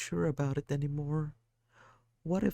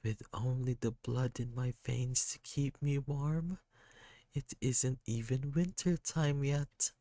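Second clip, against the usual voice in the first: put-on crying kid; 7 semitones higher than usual. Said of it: "They just cry".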